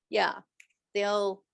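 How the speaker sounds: usual speaking voice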